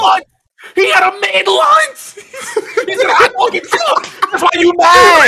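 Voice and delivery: high, loud laughing tease; laughing shout. Real, not acted. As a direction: cackle through the words. Laughing while teasing.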